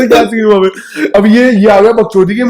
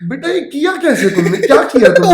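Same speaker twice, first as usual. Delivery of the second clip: higher-pitched, incredulous cop voice; baffled cop; 5 semitones higher than usual. Retold or imitated. Policeman asks the boys how they crashed, incredulous. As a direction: sound amazed at the stupidity.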